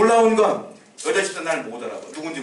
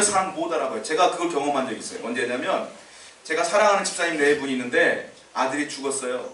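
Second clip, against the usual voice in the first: ordinary delivery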